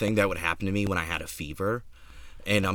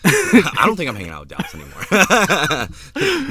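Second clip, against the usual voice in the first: laughing through the words; can barely talk for laughing; 8 semitones higher, 8 dB louder, slower than usual. Retold or imitated. Laughing through quoted line.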